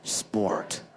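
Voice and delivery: "sport" with a strong American growled R; American accent. Shown as a demonstration. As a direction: draw out the R in "sport", American style. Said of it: "You know, sport".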